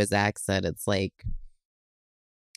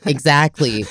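ordinary talk

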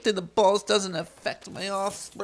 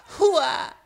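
karate cry; 11 semitones higher, much slower than usual. Acted. Vain student shows kata.

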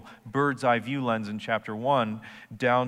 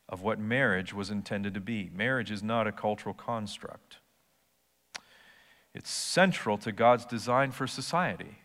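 ordinary talk

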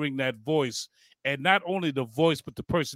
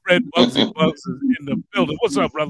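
loud announcer call, ladies and gentlemen; announcer intro; 5 semitones higher, 10 dB louder than usual. Acted. Host announcing the radio legend to the audience, announcer voice. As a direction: bring the guest on like a boxing announcer.

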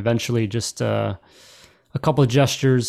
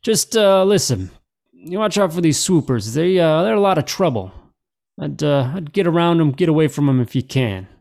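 higher, lilting officer voice; higher in-character friendly caution; officer giving a warning; 5 semitones higher, 3 dB louder than usual. Acted. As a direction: warn travellers off as a local officer. Officer warns about swoopers.

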